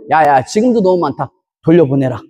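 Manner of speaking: low, solemn voice of God speaking down; God's voice. Retold or imitated. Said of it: "하나님 뭐라 하시냐?". (낮고 엄숙한 하나님의 목소리)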